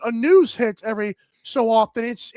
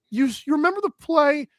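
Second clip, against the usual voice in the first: ordinary talk, nothing marked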